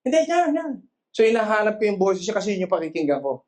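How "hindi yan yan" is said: high, urgent calling out; imitated game onlooker. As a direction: coach a blindfolded player in a raised pitch. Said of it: "distinguish ko yung boses ng nanay ko". (mataas at nagmamadaling pagtuturo sa palaro)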